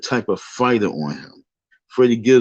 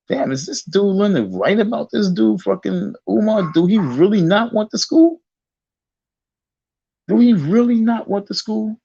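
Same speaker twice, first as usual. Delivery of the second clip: incredulous; 10 semitones higher than usual. Retold or imitated. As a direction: squeak it out in disbelief. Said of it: "because I'm like".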